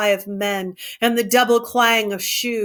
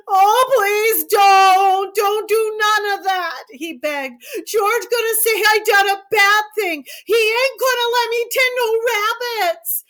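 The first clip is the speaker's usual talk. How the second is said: higher, louder tearful pleading, slowing; begging; 13 semitones higher, 4 dB louder than usual. A narrator's reading. Lenny begging Curly's wife in tearful panic. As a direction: beg in panic, near sobbing.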